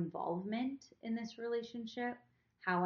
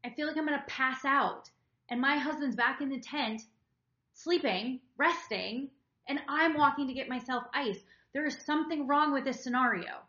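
louder, fed-up inner rant; retold exasperation; 6 semitones higher, 6 dB louder than usual. Retelling her resentment at the camp, exasperated and loud. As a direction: vent like you've had enough. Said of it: "I'm just sitting there just like".

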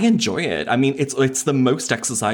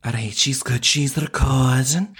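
Scouse accent. Shown as a demonstration. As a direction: redo it in broad Scouse. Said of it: "Do it in Scouse".

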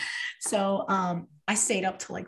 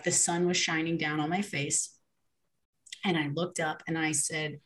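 usual speaking voice

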